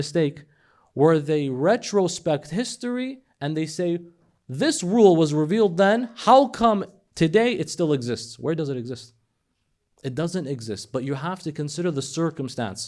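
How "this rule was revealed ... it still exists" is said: mock indignant question. Mocking critics' retrospective question.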